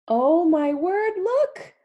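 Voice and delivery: high, loud and slow, amazed; surprised exclaim. Acted. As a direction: gasp it out to the child in wonder. Surprised at escaping animals.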